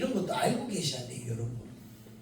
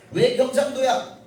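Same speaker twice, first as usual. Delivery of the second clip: normal talking voice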